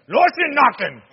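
loud, high, harsh "Knocking, knocking" in a mock German accent. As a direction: bark "Knocking, knocking" like an ugly German parody. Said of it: "In German, it's like".

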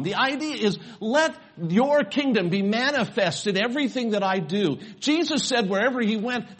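high, loud, fervent pulpit voice; preaching at full force. Real, not acted. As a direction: proclaim it to the whole congregation.

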